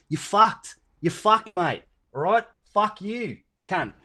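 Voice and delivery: high, loud Aussie telling-off; Australian accent. Acted. Guest mock-scolding anyone who denies singing in the shower, Australian accent. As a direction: put on a punchy Australian accent. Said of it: "That was a nice Australian accent there".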